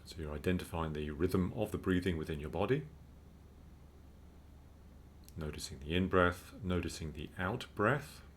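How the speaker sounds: calm, unhurried meditation guide; measured mindfulness instruction with spacious pauses; guided meditation. Real, not acted. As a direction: guide listeners gently into breathing, with long pauses.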